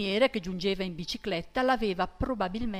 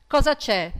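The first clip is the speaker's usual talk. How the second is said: loud, pitched-up question; loud demand; 5 semitones higher, 9 dB louder than usual. A narrator's reading. Brigadier translating his Sardinian question, loud. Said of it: "tradusse infine".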